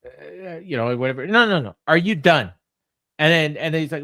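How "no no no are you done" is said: louder, pressing repeat; mock insistent repeat. Brody pressing Max again, louder. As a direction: push it harder the second time. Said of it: "Max is like".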